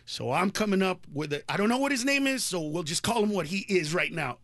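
ordinary delivery

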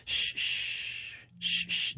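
soft unvoiced shushing. Dentist shushing an angry tooth, softly. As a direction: hush it like soothing a baby. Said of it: "he would say things like".

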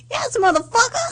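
Samuel L. Jackson impression. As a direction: shout it like Samuel L. Jackson, cracking up. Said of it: "What about Samuel L. Jackson?".

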